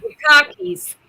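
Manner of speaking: playful New England repeat of cockies, without the r; New England accent. Retold or imitated. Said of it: "we were talking in accent".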